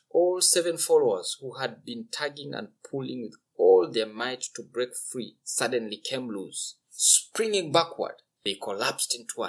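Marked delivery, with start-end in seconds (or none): none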